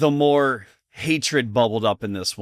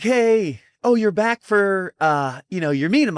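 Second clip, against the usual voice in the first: mock receptionist greeting; 9 semitones higher than usual. Receptionist greets him returning.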